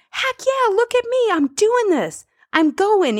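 high-pitched, elated pep talk to yourself; triumphant self-cheer. Acted. Acted self-cheering quote.

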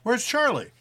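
loud, high call to the house. Acted. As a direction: call her name across rooms. Calling out at dinner.

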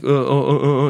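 repeated stuck syllables, as if choking; flustered stammering. Retold or imitated. Comic freezing up mid-show in French, flustered stammer. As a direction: act out getting stuck for words.

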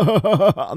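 loud, high mock giggle; big put-on silly laugh; imitated daughter's laugh. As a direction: cackle like a kid pleased with nonsense.

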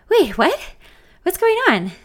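alarmed, high and loud; sudden worried panic; panicked. Acted.

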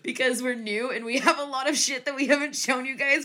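laughing through the words. Real, not acted. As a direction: giggle while talking. Laughing at own silliness.

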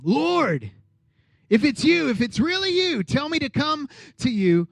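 high, pleading voice of Peter. Retold or imitated. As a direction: call out hopefully to the Lord. Peter calling to Jesus.

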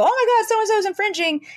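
high alarmed "oh my God"; squeaky mock panic about infringement; mock panicked client. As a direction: play the frantic client who thinks they're being copied. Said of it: "people who come to me and go".

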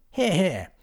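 loud, high cheer of approval; hearty shouted hear hear; shout of agreement. A narrator's reading. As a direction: call it out like a toast. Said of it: "shouted Hobgob in agreement".

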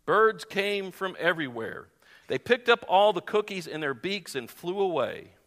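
measured storybook narration with lively character lines; animated read-aloud; children's story reading. Acted. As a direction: read it like a picture book, phrase by phrase.

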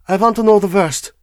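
high, loud, brisk demand. A narrator's reading. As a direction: pitch it up and press firmly. First take, before lower repeat.

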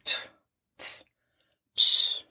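tongue clicks and a hushed "psh"; imitated dismissive scoff. Blake waving off Crystal's accusation, with imitated dismissive scoffs. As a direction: scoff wordlessly, brushing it off.

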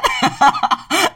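high burst of real laughter. Real, not acted. Host laughing at his own point, a high laugh. Laughs about racism helping him.

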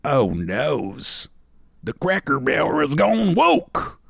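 doom-laden Southern voice, slowing at the end; hammed-up end-of-days lament, mock Southern accent. Host's last post read as a mock Southern doomsayer. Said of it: "I'm gonna do one more".

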